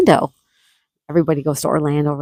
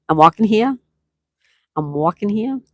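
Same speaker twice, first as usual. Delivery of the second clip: higher mock New York accent; 5 semitones higher, 4 dB louder than usual. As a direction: play the indignant New Yorker. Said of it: "the New York accent".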